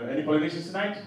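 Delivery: normal talking voice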